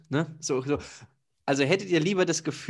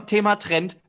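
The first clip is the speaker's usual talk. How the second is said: ordinary talk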